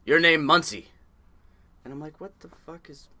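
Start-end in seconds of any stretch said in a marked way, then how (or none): 0.0-0.9 loud, higher decree in broken English; blunt foreigner. Retold or imitated. Foreign crewmate renaming the new guy, loud and blunt. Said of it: "Czechoslovakian that barely spoke any English". 2.2-3.1 much softer, higher puzzled question; baffled aside. Retold or imitated. New deckhand puzzled by his nickname, quietly baffled.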